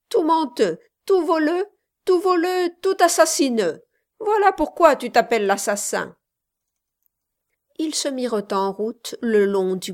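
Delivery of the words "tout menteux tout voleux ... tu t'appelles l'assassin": put-on high, loud singsong taunting of a girl; playful teasing. A narrator's reading. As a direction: chant it teasingly at the boaster. Laughing girl teases the boaster.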